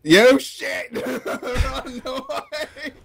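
loud panicked laughing yell; being chased. Real, not acted. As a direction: shout it while laughing in panic. Being chased by forty players.

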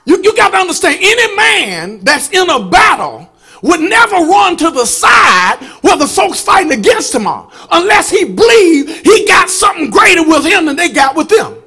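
loud, rising gospel preaching; full-force preaching. Acted. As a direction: preach it hard to the congregation.

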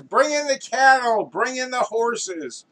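high, loud rallying orders; director's shout. Acted. Director ordering in cattle and horses, shouting. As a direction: shout commands over the crowded set.

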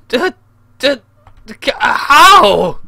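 loud, high, drawn-out shout; shouty voice. Real, not acted. Gamer baffled by the plane, long incredulous shout. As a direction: stretch it into a baffled yell. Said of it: "my shouty voice".

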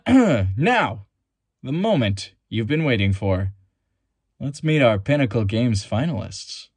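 big, booming TV host with swooping pitch; milk every phrase like a self-important show host; Guy Henderson, hyperbolic announcer. Acted. Chief host opens the televised games, hyperbolic and showy announcer. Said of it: "He's a hyperbolic announcer that has many years of experience".

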